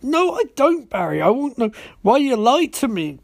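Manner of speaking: lightly voiced whiny Iris; mock Iris. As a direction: parody her as prim and wounded.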